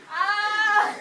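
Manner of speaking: loud high scream. Acted. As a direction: scream as you're swallowed. Being engulfed.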